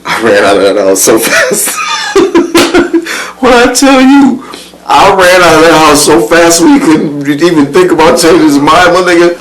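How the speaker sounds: laughing hard, voice jumping high; gleeful laugh through the story. Real, not acted. Laughing at memory.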